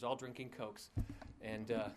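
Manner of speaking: ordinary delivery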